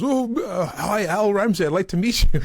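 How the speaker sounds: high, loud starstruck gush; gushing fan greeting. Retold or imitated. Colleague imitated meeting Al, high eager gush. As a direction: fawn over the celebrity.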